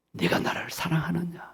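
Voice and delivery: soft whispered question, not scolding; a hushed loving whisper from the Lord to Peter; tender whisper. Acted. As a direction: breathe it gently as a loving question. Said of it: "야단하거나 책망하는 말이 아니라".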